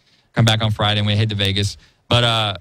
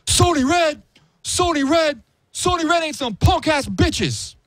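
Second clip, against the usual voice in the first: high, slow, far-off mock rant, much higher in pitch; Stephen Baldwin in my face; slower than usual. Retelling Stephen Baldwin getting in his face, high mock rant. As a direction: squeal it right up in his face. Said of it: "got up in my face and started going".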